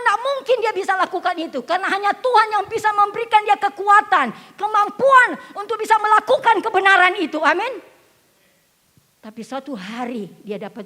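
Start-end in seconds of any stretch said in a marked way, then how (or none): 0.0-7.9 high, loud preacher's cry; impassioned sermon delivery; full-force preaching. Acted. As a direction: preach it out to the congregation.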